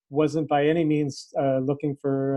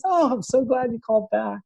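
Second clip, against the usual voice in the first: high, bright delighted relief; delighted boss; 9 semitones higher than usual. Retold or imitated. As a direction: beam with relief at the callback. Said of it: "she goes".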